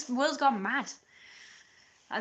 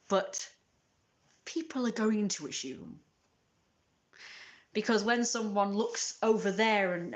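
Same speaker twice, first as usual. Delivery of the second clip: ordinary talk, nothing marked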